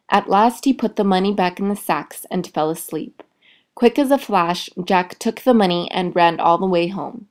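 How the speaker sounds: ordinary delivery, nothing marked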